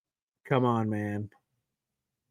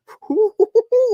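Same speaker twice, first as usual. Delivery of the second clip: high, loud burst of delighted laughter; high giddy laugh; 7 dB louder than usual. Real, not acted. Fan delighted by Jets score, high giddy laugh.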